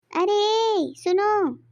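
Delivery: high call across the field; wife calling her husband across the field. Acted. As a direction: call him from a distance, high.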